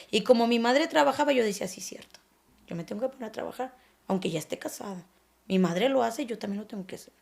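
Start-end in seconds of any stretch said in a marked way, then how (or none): 1.7-7.1 softer, almost whispered thoughts; hushed, resigned, as if to herself; quiet inner voice. Retold or imitated. Her inner thoughts, retold. (voz baja, casi susurrada)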